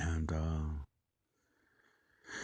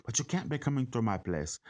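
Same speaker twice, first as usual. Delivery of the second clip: put-on Irish-sounding accent; 7 semitones higher, 4 dB louder than usual. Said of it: "sounded like he was Irish".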